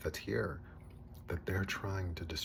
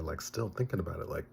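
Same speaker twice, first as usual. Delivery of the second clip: normal talking voice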